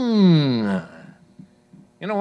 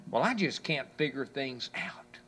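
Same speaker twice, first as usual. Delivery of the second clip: whispered, softer, defeated; 6 semitones lower, 10 dB quieter than usual. Retold or imitated. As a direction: breathe it out as a hopeless whisper. Quoting a discouraged complaint.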